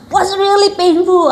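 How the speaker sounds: high, slow mock lament; hammed-up, stretched complaint; dramatised pain. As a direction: draw it out high to show the pain. Stressing her own painful experience.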